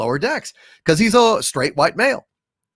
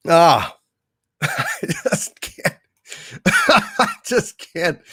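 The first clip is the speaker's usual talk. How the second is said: exasperated laugh; 2 semitones higher, much slower than usual. Real, not acted.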